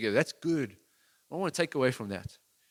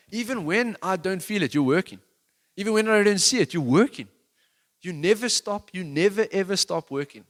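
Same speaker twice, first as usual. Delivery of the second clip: loud, higher declaiming of the lyric; passionate lyric quote; 7 semitones higher, 8 dB louder than usual. Acted. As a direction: proclaim the song words with fervor. Quoting worship song lyrics.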